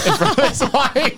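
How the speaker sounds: high laughing through the words. Real, not acted. Telling friends about the old man, laughing high. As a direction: crack up as you tell it.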